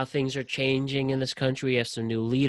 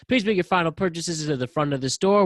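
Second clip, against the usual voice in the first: loud, higher-pitched store closing announcement; yelled announcement; 4 semitones higher, 8 dB louder than usual. Retold or imitated. Retail worker re-enacting his closing-time page to Kohl's customers, yelled. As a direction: belt out the closing page with over-the-top passion. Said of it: "I would get yelled at or scream".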